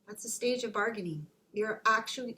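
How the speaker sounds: ordinary talk, nothing marked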